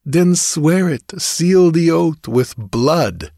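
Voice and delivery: slow solemn demand. Acted. Julie demands an oath.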